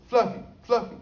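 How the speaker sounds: high, loud call of the cat's name. Retold or imitated. Inmate calling his missing cat, loud and high. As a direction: sing out for the cat. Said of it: "You know, I said".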